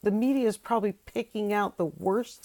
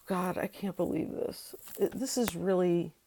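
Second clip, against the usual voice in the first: ordinary talk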